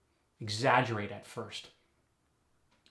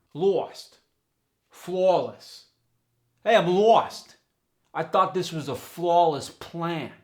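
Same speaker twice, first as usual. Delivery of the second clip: much higher, loud New York "lost" as lawst; 7 semitones higher, 10 dB louder, slower than usual. Shown as a demonstration. Teacher demos the New York aw, high and loud. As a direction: stretch "flawless" to flawrless with a W glide.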